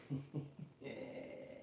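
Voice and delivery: soft breathy chuckle. Real, not acted. Reacting to a viewer's comment.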